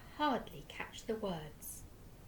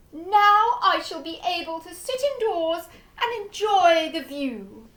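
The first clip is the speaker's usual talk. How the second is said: loud, high exclamations of wonder; delighted old duke; 10 semitones higher, 17 dB louder than usual. A narrator's reading. Duke marvels at his clean windows, loud and high. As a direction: shout your amazement.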